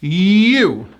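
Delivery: You drawn out from a held Y; You, long and falling; Y sound. Shown as a demonstration. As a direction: stretch You, pushing the Y. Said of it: "point to me in the video and say".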